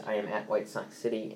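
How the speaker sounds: ordinary delivery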